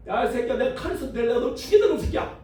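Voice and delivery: high-pitched acted outburst of anger; angry threat. Balaam threatens the donkey that talked back, angry. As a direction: curse it out in mock fury, pitched high.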